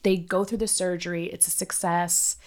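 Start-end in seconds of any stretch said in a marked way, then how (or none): 1.3-2.4 slow, ironic "It's a success"; loaded. Real, not acted. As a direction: say it with heavy irony. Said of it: "I say that loadedly".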